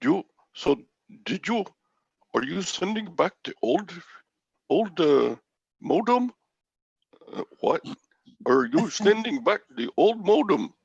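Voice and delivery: high-pitched imitation of an Indian tech-support agent's accent; Indian-accent impression of a phone agent; imitated Indian accent. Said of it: "you could do that accent".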